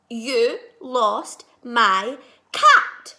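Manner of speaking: loud, slow accusing shout; angry shout. Acted. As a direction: blame him at full volume. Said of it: "I shout".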